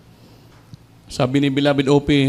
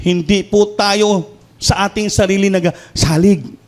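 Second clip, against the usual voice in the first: slow emphasis; 5 semitones higher, slower than usual. Acted. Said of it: "slow emphatic sermon line".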